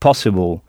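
possible with the first syllable hit hard, low; accent anglais sur po; English stress on po. Shown as a demonstration. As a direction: punch the po in possible. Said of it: "en insistant bien sur le po".

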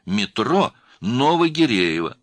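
slow, loud "метро Новогиреево", carefully separated; spelling it out. A narrator's reading. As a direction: dictate "метро Новогиреево" high and drawn out. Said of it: "Она сказала по буквам".

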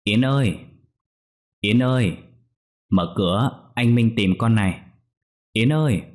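father calling his daughter through the locked door, rising alarm. A narrator's reading. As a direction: call his daughter's name through the door, growing anxious. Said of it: "vừa gõ cửa vừa gọi".

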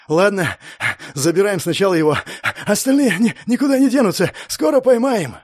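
out of breath. A narrator's reading. Winded pursuer giving orders about the prisoners, out of breath. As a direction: break the orders up with gasps for air. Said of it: "сказал запыхавшийся мужик".

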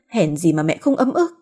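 usual speaking voice

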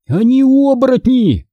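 high-pitched urgent exclamation; half-voiced shout, pitched well up; mild cry. A narrator's reading. Boy warning Hanna about his aunt and uncle, mildly crying out. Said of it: "закричал я".

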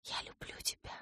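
breathy whisper in the ear. Acted. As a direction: whisper it softly, close to the ear. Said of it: "прошептал мне на ухо".

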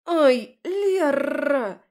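громкий злой окрик, высоко и громко; рявкни на подругу, которая толкнула; рычит не хуже оборотня. A narrator's reading. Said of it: "прорычала не хуже оборотня". (героиня злобно рычит на подругу Леру)

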